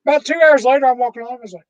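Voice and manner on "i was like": usual speaking voice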